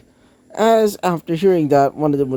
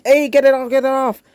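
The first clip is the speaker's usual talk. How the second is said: high-pitched, comic alarm; urgent yelp; 10 semitones higher, faster than usual. A narrator's reading. Jiro with a dog biting her hand, yelping in comic alarm. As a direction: cry out high, more funny than hurt.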